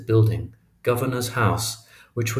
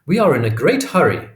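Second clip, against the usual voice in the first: louder put-on European accent, impatient and demanding; haughty European accent; 5 semitones higher, 6 dB louder than usual. Stepmother pushes past the queue, impatient European accent. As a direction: press the waiter in a grand European accent. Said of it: "in her best European accent".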